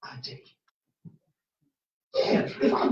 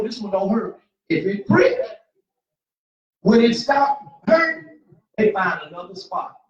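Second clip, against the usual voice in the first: rising preacher's holler; full-force preaching; 8 dB louder than usual. Acted. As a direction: preach it loud to the whole church.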